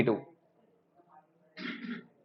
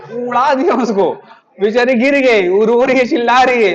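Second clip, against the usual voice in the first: higher and louder, rushing through the chaos; excited retelling; 5 semitones higher, 5 dB louder than usual. Retold or imitated. Telling students about the woman the rickshaw knocked over, excited and loud. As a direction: relive the crash, amazed and loud.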